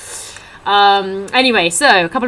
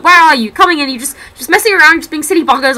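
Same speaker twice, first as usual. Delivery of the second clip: higher, louder mock scolding; mock telling off; 8 semitones higher, 7 dB louder than usual. Comedian heckling a latecomer, louder mock scold. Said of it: "He's like".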